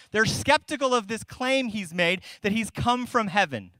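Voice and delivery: ordinary delivery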